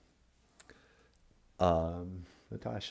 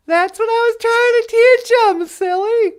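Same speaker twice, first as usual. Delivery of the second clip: much higher, louder playful voice; teasing; 9 semitones higher, 5 dB louder than usual. Pretend. Playful host teasing her co-host in a high voice. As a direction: tease like a silly child. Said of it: "playful teasing voice".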